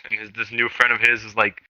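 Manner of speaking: ordinary delivery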